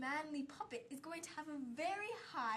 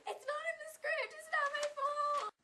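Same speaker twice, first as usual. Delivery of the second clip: much higher whiny protest; squeaky protest; 13 semitones higher than usual. Acted. Kid defends himself to the crew, squeaky and whiny.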